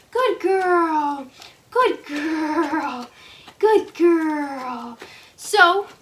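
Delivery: normal talking voice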